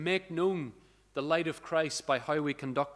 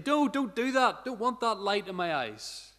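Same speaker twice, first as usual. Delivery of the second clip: mock protest; 6 semitones higher than usual. Child protesting torch light.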